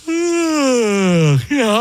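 long drawn-out sleepy sigh; husband acting out his sleepy yawn; mock tired sigh. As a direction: sigh it like you can barely stay awake. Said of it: "I'm tired".

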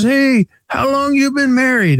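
high, exaggerated Italian car dealer voice; Italian accent. Car dealer answers the caller, in a heavy Italian accent. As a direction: mimic the Philly dealer's thick Italian accent. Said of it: "very heavy Italian accent".

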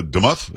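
Demuth as duh-MUTH, short vowel, questioning; second guess pronunciation. Shown as a demonstration. Host asking guest about the name, tentative duh-MUTH for Demuth. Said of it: "How does she say it?".

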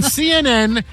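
normal talking voice

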